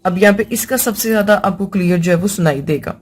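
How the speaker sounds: ordinary delivery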